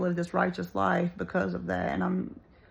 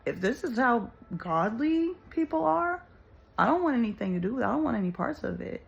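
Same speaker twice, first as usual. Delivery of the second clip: a touch of fed-up rejection, pitched higher; indignant rejection; 5 semitones higher than usual. Pretend. Imagined non-believer refusing godly people, indignant and higher. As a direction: push away the church people who hurt her, higher and fed up. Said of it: "if that were me".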